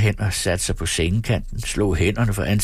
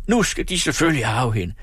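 high-pitched, indignant rant; worked-up, outraged cry; angry outburst; 8 semitones higher than usual. A narrator's reading. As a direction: rage at the injustice, pitch climbing. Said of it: "råbte han".

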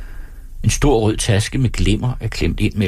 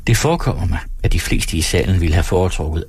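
usual speaking voice

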